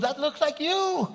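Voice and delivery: high, loud, slow teasing; wife teasing. Retold or imitated. Wife teasing husband about the TV man, high slow. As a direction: tease him slowly and gleefully. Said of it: "She goes".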